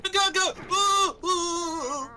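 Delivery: high loud cheer; excited high shout. Real, not acted.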